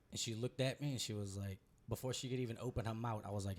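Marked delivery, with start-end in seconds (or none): none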